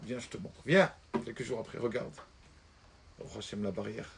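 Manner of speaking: hushed son. Acted. Son shows the fence quietly.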